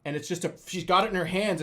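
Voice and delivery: plain reading voice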